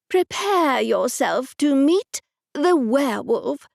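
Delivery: slow, grand announcement; dramatic. Acted. Aunt Agnes to narrator, dramatic slow announcement. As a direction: unveil it like a showman. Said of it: "Aunt Agnes said dramatically".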